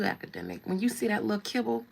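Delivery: ordinary delivery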